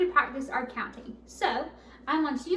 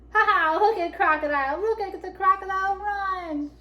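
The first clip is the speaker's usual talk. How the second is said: loud, high jubilant taunt; gleeful crowing; 7 semitones higher, 7 dB louder than usual. A narrator's reading. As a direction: crow over the fleeing crocodile. Animals gloat as Crocodile flees.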